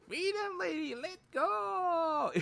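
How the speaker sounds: very high squealed imitation; high-pitched mock call; mimicked streamer cry.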